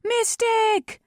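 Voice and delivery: very high, loud accusing yell; crowd's answering cry. A narrator's reading. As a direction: yell back as an angry mob. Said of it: "the crowd answered back".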